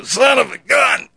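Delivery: loud painful yell. Retold or imitated. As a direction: yell it out from the floor in pain. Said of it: "I say something really loud".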